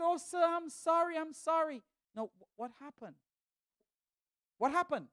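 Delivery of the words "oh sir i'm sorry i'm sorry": lightly voiced, higher apologetic woman; a hint of a flustered businesswoman apologizing; apologizing lady. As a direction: plead sorry in a slightly raised voice. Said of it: "She said".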